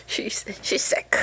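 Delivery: hushed slow aside whisper. Real, not acted. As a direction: whisper it like a secret. Aside about sick friend.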